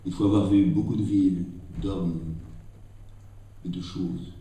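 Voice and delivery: measured recital of a Rilke prose passage; poem recitation. Acted. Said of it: "Je vais tenter de vous dire un court passage". (lecture récitée d'un passage de Rilke)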